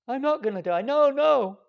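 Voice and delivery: loud, high cry of denial; mock denial. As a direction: shout it off like a man refusing death. Denial of death, acted quote.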